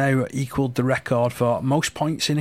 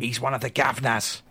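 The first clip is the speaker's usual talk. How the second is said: mock-menacing London accent; lightly hammed-up London accent; 4 dB quieter than usual. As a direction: play up a London villain accent. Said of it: "sounds more menacing when you do it".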